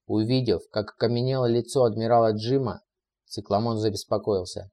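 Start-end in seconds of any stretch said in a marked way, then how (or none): none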